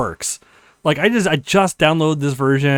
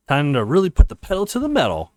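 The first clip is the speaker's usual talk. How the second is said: ordinary talk, nothing marked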